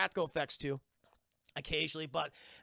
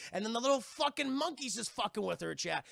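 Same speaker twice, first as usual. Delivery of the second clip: ordinary delivery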